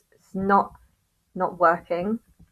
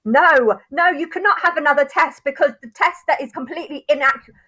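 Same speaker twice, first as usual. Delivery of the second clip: high, loud sneering mimicry of the court's refusal; mocking court officials; 9 semitones higher, 8 dB louder than usual. Vlogger mocking the court that refused her test, loud high mock. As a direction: throw their refusal back in a shrill mocking voice. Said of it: "they came back and said".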